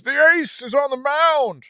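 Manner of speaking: high, loud hammed-up intro voice; podcaster voice. Host mocking his recorded intro, exaggerated podcaster voice. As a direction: proclaim it big and high, mocking your own intro. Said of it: "in my little podcaster voice".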